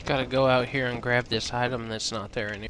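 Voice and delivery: usual speaking voice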